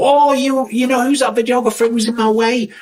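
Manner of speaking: high-pitched mock rant; exaggerated high voice running into babble; complaining guest. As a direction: imitate a guest whingeing on and on. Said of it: "guests who suddenly go".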